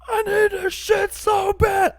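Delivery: high, top-of-the-lungs desperate scream. Acted. Desperate driver screaming at a red light to change, screaming. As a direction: scream it full out, begging. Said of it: "I'm screaming all of this, top of my lungs".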